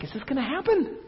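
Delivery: acted nervous viewer, pitched high; anxious suspense. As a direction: ask it as if on edge watching a tense film. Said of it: "This was a tense story".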